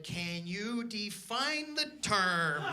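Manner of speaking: slow, careful deadpan question. Retold or imitated. To the insurance agent, slow deadpan. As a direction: ask it slowly, playing dumb. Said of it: "And I said".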